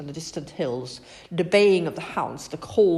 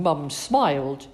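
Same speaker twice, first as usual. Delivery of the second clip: plain reading voice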